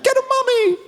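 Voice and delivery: put-on very high little-boy cheer; squeaky kid cheer. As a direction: cheer like a small child. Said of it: "on my face like".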